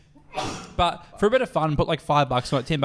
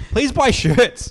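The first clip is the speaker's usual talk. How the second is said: much higher, louder and slow, hammed-up; mock pleading plug; 10 semitones higher, 8 dB louder, much slower than usual. Plugging podcast merch to listeners, hammed-up begging. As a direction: beg the listeners in a silly put-on voice.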